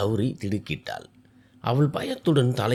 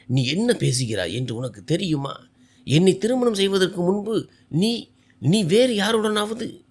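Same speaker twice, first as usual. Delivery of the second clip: angry demand; 7 semitones higher than usual. A narrator's reading. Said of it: "கோபத்தால் சிவந்தன".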